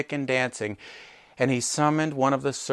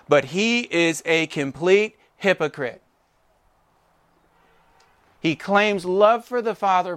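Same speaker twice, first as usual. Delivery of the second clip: building preaching; 5 semitones higher, 5 dB louder than usual. Acted. Rising sermon point on hypocrisy.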